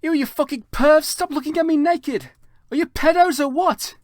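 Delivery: high, loud disgusted scream. Acted. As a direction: shriek at the peeping pervs. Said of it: "I screamed".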